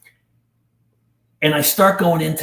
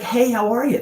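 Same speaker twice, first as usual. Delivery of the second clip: breezy, higher-pitched casual hello; nonchalant greeting; 8 semitones higher than usual. Retold or imitated. Abuser greeting his grown victim, breezy and nonchalant. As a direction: toss it off like nothing ever happened. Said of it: "just nonchalantly".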